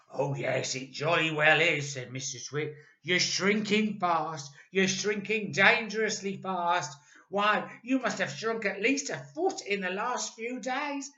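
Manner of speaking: mock-serious Mister Twit. A narrator's reading. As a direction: pretend grave concern.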